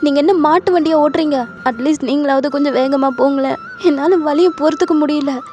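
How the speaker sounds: high, strained, impatient nagging; pained scolding. A narrator's reading. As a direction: scold him through the contractions.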